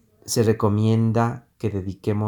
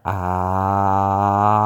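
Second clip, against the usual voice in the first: "ah" held on one pitch, growing louder; rising volume ah; 6 dB louder than usual. Shown as a demonstration. Teacher shows students the breathing drill, a steady swelling "ah".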